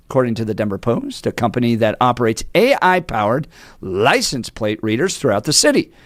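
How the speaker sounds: normal talking voice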